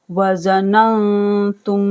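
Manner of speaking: ज़ननतुम with a hummed, hidden nun; slow recitation with ghunna; nasal ghunna. Shown as a demonstration. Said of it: "हम गुन्ना करेंगे".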